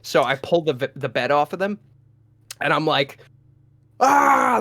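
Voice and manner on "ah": high, loud caveman yell; mock full-lung scream. Facing the intruder under bed, mock scream. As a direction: act out an ape-mode roar. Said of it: "just screaming at the top of my lungs".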